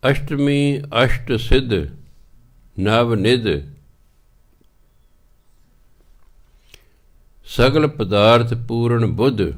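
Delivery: slow, measured reading of the pauri; scripture recitation. Acted. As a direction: intone each phrase with long pauses. (ਪਉੜੀ ਦਾ ਹੌਲੀ ਪਾਠ)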